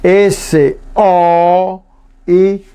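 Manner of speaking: slow spelling with Spanish letter names; letra por letra. Shown as a demonstration. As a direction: spell it out, pausing between drawn-out letters. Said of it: "Le digo letra por letra". (deletreo lento en castellano, letra por letra)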